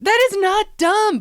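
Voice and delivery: high, indignant protest; playful high-pitched objection. Real, not acted. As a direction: jump up in pitch to defend it. Defending s'mores fries.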